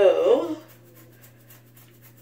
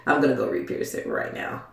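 quick whisper; 4 semitones lower, 5 dB quieter, faster than usual. Shown as a demonstration. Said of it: "No, but I'm gonna go re-pierce".

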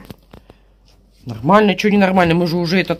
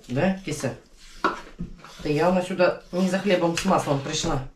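talking to a cat; 9 dB quieter than usual. Real, not acted.

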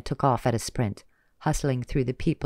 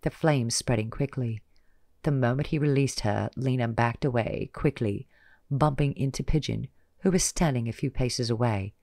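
usual speaking voice

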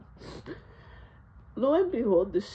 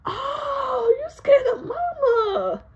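high, loud, slow mocking taunt; 6 dB louder, much slower than usual. Kid taunting dad about mama. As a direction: draw out the jab at him.

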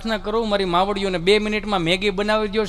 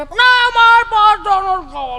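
put-on much higher, louder, slow childish whine; whiny spoiled child; 12 semitones higher, 7 dB louder, slower than usual. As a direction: refuse like a spoiled brat. Spoiled child refusing food. (ઊંચા, મોટા, ધીમા અવાજે જીદ્દી બાળક)

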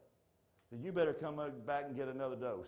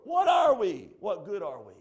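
high, loud preacher's cry; shouted question; 6 semitones higher, 11 dB louder than usual. Real, not acted. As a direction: call it out to the whole church. Preacher cries out to congregation.

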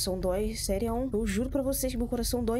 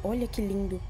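ordinary talk

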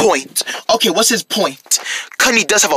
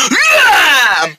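long, high whooped yeah; rallying cheer; 7 semitones higher than usual. Acted. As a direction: whoop it out in agreement. Toys cheer on their plan.

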